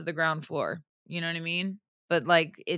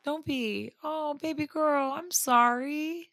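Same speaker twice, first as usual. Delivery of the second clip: tender comfort; 8 semitones higher, slower than usual. Real, not acted. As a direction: gently soothe a friend who is crying. Comforting crying friend.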